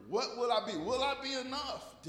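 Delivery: usual speaking voice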